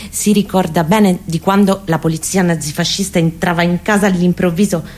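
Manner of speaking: ordinary talk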